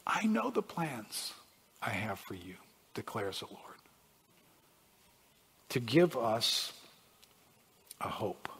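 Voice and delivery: soft, low reading with long pauses; hushed scripture reading. Acted. Preacher rereading God's promise to the congregation, hushed and low. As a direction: let the verse sink in quietly.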